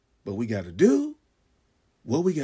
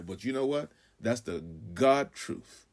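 ordinary delivery

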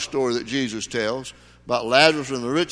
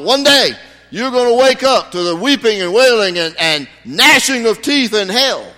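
loud, high fiery street preaching; street preacher; 7 semitones higher, 6 dB louder than usual. Retold or imitated. Street evangelist warning a downtown crowd, loud and fiery. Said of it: "a street evangelist who was preaching".

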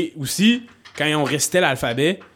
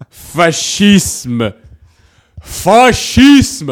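louder and higher on each repeat, drawn-out word; escalating trigger word; 6 semitones higher, 8 dB louder, much slower than usual. Pretend. Testing if the word triggers Lucam, mock-dramatic escalating shout. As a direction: start loud, build to a high shout.

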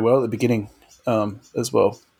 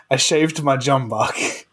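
laughing; 2 semitones higher, slower than usual. Real, not acted. Laughing through the example sentence.

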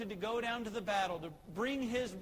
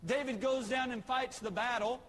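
ordinary talk

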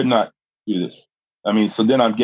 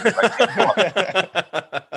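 drawn out through laughter; stretched, giggling admission; laughing; 8 semitones higher, much slower than usual. Real, not acted. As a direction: laugh it out slowly. Laughing at his own story.

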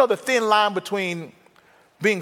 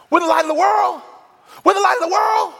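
higher and louder, rising to a shout; swelling from realisation to a joyful shout; building excitement; 13 semitones higher, 6 dB louder than usual. Retold or imitated. Robert grasping the verse, getting louder and thrilled.

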